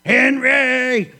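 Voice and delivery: loud, high strangled cry; pinned evangelist calling his brother; muffled cry. Retold or imitated. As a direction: yell it from under the weight. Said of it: "Benny says one thing".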